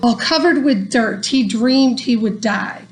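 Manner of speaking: ordinary delivery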